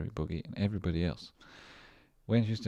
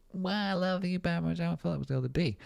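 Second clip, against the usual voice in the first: squeaky high playful greeting; high mock voice for a friend's birthday; high silly voice; 11 semitones higher, faster than usual. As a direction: pitch way up like a cartoon.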